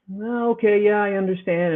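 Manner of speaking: a lightly voiced, cowed doctor; mocking the doctor caving in; meek doctor.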